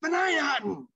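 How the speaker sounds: high, drawn-up heckle; heckling kid. Retold or imitated. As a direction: imitate a kid pestering from the stands. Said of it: "and this kid".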